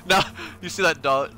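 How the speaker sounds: ordinary talk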